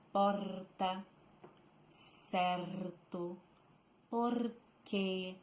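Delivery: "Porta, certo, por quê" with R vibrating on the tongue tip; trilled R. Shown as a demonstration. Teacher demonstrating the gaúcho R, "Porta, certo, por quê" with tongue-tip trill. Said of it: "the tip of the tongue, vibrating".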